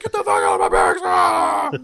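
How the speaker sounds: loud barking yell; drill sergeant shout. Retold or imitated. Drill sergeant throwing garbage cans, yelling. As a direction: roar them out of bed.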